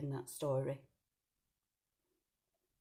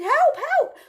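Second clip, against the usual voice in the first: much higher, louder scream; shouting for help; 13 dB louder than usual. Acted. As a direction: scream for the woodcutter.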